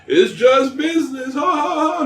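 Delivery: put-on high-pitched sly old man. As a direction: cackle it like a crafty old shopkeeper. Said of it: "he's like".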